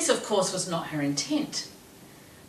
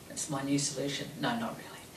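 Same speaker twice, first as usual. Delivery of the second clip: usual speaking voice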